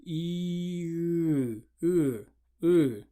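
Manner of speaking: E then uh as the tongue moves back; E sliding into ы. Shown as a demonstration. Teacher showing the tongue moving back. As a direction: glide from E back to uh. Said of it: "move your tongue back".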